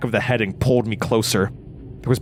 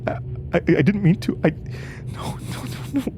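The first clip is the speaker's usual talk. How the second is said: stammering, whispers breaking into high cries; panicked stammer; 9 semitones higher, faster than usual. Acted. After killing the woman, she panics in a breaking whisper. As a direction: fall apart, gasping no into a high wail.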